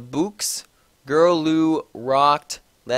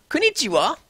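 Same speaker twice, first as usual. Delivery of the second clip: much higher chirpy hello; perky greeting; 11 semitones higher than usual. A narrator's reading. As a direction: chirp it brightly. Teacher greeting in Japanese.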